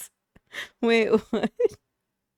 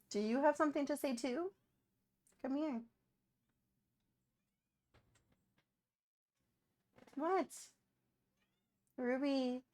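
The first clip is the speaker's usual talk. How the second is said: soft, higher coaxing voice; pet talk; 5 semitones higher, 12 dB quieter than usual. Real, not acted. Calling her pet Ruby over, soft and high. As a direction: coax the pet gently.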